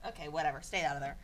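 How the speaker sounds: normal talking voice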